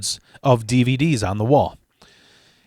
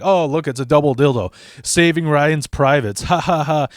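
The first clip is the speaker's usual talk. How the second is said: louder, quick goofing around with put-on laughs; mock laughing; 3 semitones higher, 5 dB louder than usual. Friends joking at shop titles, mock laughing. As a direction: crack up at silly titles, hammed-up. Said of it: "we're just laughing at everything".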